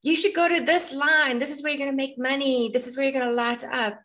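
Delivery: high-pitched mock astrologer's advice; pushy astrocartographer. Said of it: "a lot of astrogeographers or astrocartographers out there will say".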